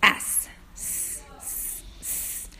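whispered S hiss, S, S, S; sounding out the letter. Shown as a demonstration. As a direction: breathe a soft hissing S sound. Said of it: "first I look at the first letter".

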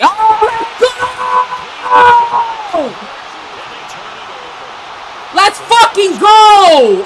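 drawn-out high celebratory yell; hype shout. Real, not acted. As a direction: stretch it out and scream with joy. Big play celebration.

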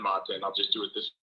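usual speaking voice

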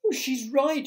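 high, loud agreement; alarmed shout. A narrator's reading. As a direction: call out in dismay. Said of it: "shouted the ladybird".

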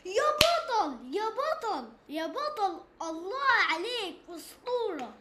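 drawn-out loud cheer. Real, not acted. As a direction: hype up a teammate, slow and loud. Hyping teammate, loud and slow.

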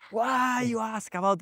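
high, drawn-out mock scolding; retold shouting. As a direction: imitate a shouting guru without raising the volume. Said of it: "Guruji starts shouting at him".

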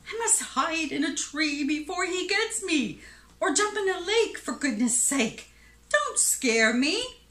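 high-pitched, mildly panicked pleading; panicky elephant. A narrator's reading. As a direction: plead as a scared storybook elephant. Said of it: "shouted the elephant".

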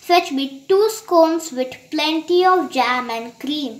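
ordinary delivery

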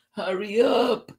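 high-pitched mild call; friend calling out. A narrator's reading. Friend calling Tisha to lunch, high mild call. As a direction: call it high, without shouting. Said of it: "called her friend".

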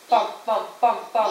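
short, evenly spaced beats; thumping sound effect. A narrator's reading. Father dragged up the back stairs, thumping sound effect. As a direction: make each word a separate thud.

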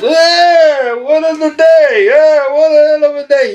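squeaky, high, loud mock of a dazed man. Knobs waking up dazed, squeaky high and loud. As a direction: pitch it way up like someone out of it. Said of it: "Knobs goes".

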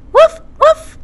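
high squeaky puppy woofs; puppy bark. Acted. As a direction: yip like a little puppy. Puppy barks in story.

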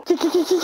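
high teasing kikiki giggle; taunting cackle. Real, not acted. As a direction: cackle at your friend's expense. Taunting cackle after jab.

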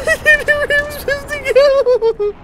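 very high, loud, squealed complaint; distressed wail. Real, not acted. Lost and killed in game.

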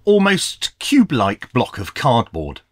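ordinary talk

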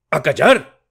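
furious father roaring for silence; shouting. A narrator's reading. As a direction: cut the son off with a shout. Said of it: "gritó".

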